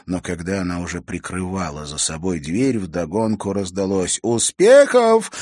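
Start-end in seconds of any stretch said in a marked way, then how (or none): 4.2-5.3 teasing cheer. Acted. Girls tease from bed.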